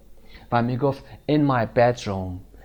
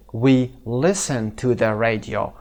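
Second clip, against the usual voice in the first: slow, careful teacher reading; slow model sentence; much slower than usual. Shown as a demonstration. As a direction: say it slowly for students to repeat. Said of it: "teacher models sentence".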